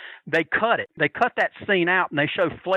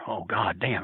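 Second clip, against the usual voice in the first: muttered dread; 8 semitones lower, 5 dB quieter than usual. Retold or imitated. Frightened bystander muttering to himself.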